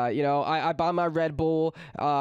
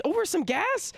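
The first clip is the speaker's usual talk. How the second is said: disbelieving; 12 semitones higher than usual. Retold or imitated. Narrator's disbelief at the Karen.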